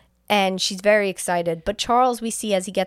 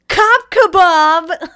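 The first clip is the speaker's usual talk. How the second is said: high, very loud, drawn-out shout; gleeful villain yell; 9 semitones higher, 12 dB louder, much slower than usual. Retold or imitated. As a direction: bellow it like a triumphant movie villain. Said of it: "and then he yells".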